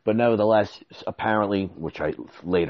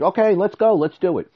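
much higher, louder keen "let's go"; eager go-ahead; 11 semitones higher, 5 dB louder than usual. Retold or imitated. Agreeing to the contractor's plan, high eager go-ahead. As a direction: jump at the deal.

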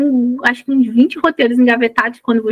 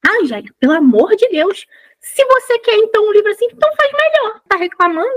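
much higher, exasperated retort; friend's mock yell; 9 semitones higher than usual. Friend scolding Angélica for complaining, high mock yell. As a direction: tease her to write a better one. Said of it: "Minha amiga deu um grito e falou assim".

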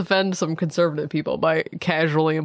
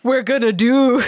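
louder, higher Scottish imitation; mock Scottish accent; 4 semitones higher, 5 dB louder than usual. Teasing the co-host's accent, mock Scottish. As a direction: exaggerate the Scottish accent, punched up. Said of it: "now you're Scottish".

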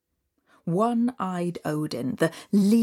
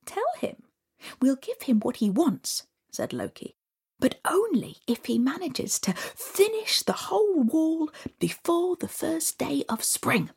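high sneaky scheming whisper; mischievous hushed plotting; sly trickster; 7 semitones higher than usual. Acted.

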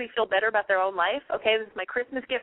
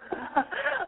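high burst of laughter; 13 semitones higher, 3 dB quieter than usual. Real, not acted.

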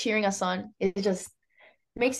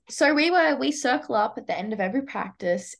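ordinary talk

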